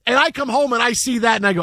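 ordinary delivery

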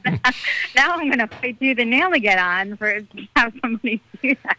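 high, laughing through the words; laughing while talking. Real, not acted. Candidate tells the host about the heckler, laughing. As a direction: laugh it off as you go.

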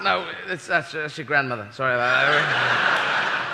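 ordinary talk, nothing marked

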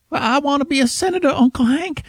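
high, fast mocking Luann whine; Luann again. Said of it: "a choice between Luann".

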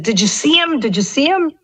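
high-pitched imitation of an excited coworker. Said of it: "she was all excited, and she said".

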